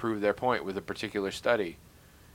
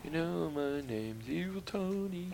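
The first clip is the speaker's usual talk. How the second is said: mock evil villain; 6 semitones higher, 4 dB quieter, slower than usual. Jokey villain intro voice.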